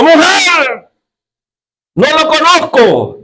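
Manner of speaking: negación aguda y alarmada, mucho más alta; niégalo con pánico, voz subida; Pedro negando. A narrator's reading. Pedro niega a Jesús. (Pedro, asustado, niega a Jesús en voz alta y aguda)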